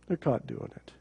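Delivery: dropped to a hush; hushed, stunned repeat; near-whisper repeat. Real, not acted. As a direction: repeat it barely above a breath. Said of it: "and then guess what?".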